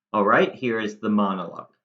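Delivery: ordinary talk, nothing marked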